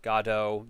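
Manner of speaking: "Godot" said as GAH-doh, silent T; variant pronunciation. Shown as a demonstration. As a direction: open "ah" first syllable in "Godot". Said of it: "different ways of saying it".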